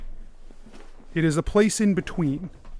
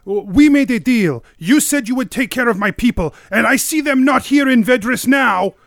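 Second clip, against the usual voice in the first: angry accusation; 5 semitones higher, 7 dB louder than usual. Acted. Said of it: "and she's like".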